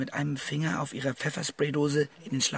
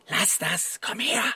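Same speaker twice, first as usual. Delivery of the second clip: urgent call; 6 semitones higher, 5 dB louder, slower than usual. Acted. Calling friend away from danger.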